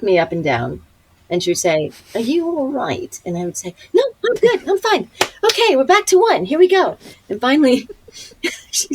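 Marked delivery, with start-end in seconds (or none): 2.1-3.2 higher, slow, doubtful British dame; Maggie Smith. Retold or imitated. Older co-star eyeing an exhausted actress, slow British impersonation. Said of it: "that's the best Maggie Smith". 3.9-7.0 re-enacted over-eager reassurance, very high; high-pitched insistence. Exhausted actress reassuring co-star between takes, re-enacted high insistence. Acting her exhausted self insisting she's fine.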